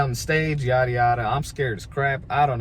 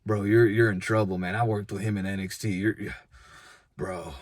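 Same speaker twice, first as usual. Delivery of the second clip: Matt Riddle; 6 semitones lower, 4 dB quieter than usual. Retold or imitated. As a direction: imitate a wrestler gravely warning a rookie, low and trailing off. Imitates Matt Riddle warning him.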